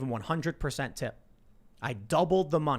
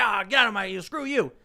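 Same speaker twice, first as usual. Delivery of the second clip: high, louder mock yelling; angry outburst; 6 semitones higher, 4 dB louder than usual. Angry exchange over the withheld tip, mock furious yelling. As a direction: imitate a garbled angry blow-up. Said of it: "could have been angry at him".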